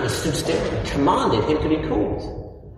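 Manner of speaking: normal talking voice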